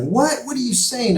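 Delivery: high, incredulous mock voice; mocking disbelief. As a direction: imitate a scoffing doubter. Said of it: "they may laugh at that, like".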